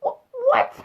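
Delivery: high, squeaky laughing "what?"; half laugh, half shock; stunned disbelief. Real, not acted. Vlogger reacting to the event, high laughing disbelief.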